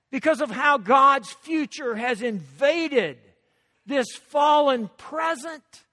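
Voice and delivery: ringing pulpit proclamation, key words punched; impassioned preaching. Real, not acted. Preacher proclaiming a new world to the congregation, impassioned. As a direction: drive the message out to the whole room.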